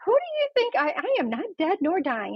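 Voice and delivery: high-pitched, incredulous retort; indignant protest. Retold or imitated. Patient protesting to her doctor, high and indignant. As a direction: squeak up in mock outrage at being fussed over.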